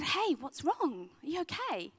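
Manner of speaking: slow, soft, reassuring questions; a youth worker softly asking a crying girl what's wrong; slow tender comfort. Retold or imitated. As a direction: ease a sobbing girl into talking, very slowly. Comforting sobbing girl in a room.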